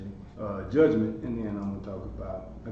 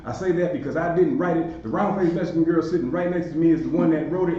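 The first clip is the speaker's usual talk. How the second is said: louder, upbeat sponsor pitch; hyped-up promo read, higher and louder; 4 semitones higher, 6 dB louder than usual. Acted. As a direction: sell the program with energy.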